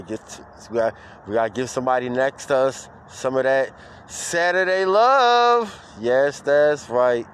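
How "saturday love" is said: high, loud, long-held word; soaring shout-out; hyped call. Real, not acted. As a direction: push it up high and hold it. Hyped shout-out of Saturday love.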